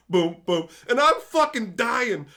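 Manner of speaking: ordinary talk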